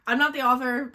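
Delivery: ordinary delivery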